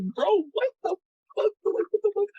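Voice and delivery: very high-pitched, incredulous squeak; retold disbelief. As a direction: replay your shock in a squeaky high voice. Retold shocked reaction to accusations.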